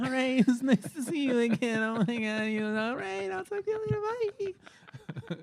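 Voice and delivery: high-pitched imitation of a woman; mock girl goodbye. Man imitating her brushing off a friend, high mock-sweet. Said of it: "She's like".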